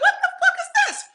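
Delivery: high, fast, laughing squeal of disbelief. Retold or imitated. Telling her audience about seeing the gorgeous man, laughing shock.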